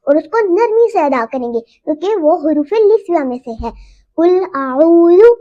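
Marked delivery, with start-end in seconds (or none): 4.2-5.3 आऊज़ु with the waw stretched one alif long; long vowel in आऊज़ु. Shown as a demonstration. Teacher shows the correct length of आऊज़ु. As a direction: hold the long u in आऊज़ु evenly. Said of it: "हम आऊज़ु को आऊज़ु नहीं पढ़ेंगे".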